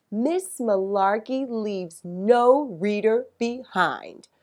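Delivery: usual speaking voice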